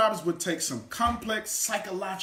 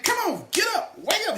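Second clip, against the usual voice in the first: much higher and loud coach call; motivating coach; 5 dB louder, faster than usual. Retold or imitated. Coach in your ear, urging you awake loudly. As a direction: rouse them like a coach in the ear. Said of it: "that coach in your ear".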